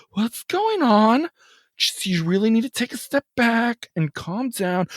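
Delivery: calm lady. Retold or imitated. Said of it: "able to stay calm".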